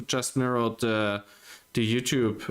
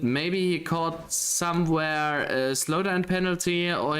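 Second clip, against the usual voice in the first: lively live play-by-play, higher; race commentary; 4 semitones higher than usual. Real, not acted. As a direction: call the race as it happens.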